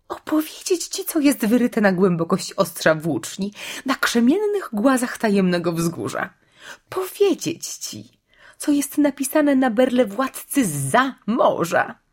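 high-pitched, squealing tirade; shrieking witch. A narrator's reading. Witch reciting the carved law to Aslan, shrieking. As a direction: shriek each question high and insistent. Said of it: "Głos czarownicy przeszedł nagle w pisk".

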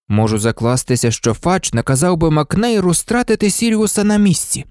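usual speaking voice

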